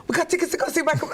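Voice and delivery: 3 blurt out the big news; 1 excited news. Retold or imitated. 4 husband announcing the tickets, excited and fast.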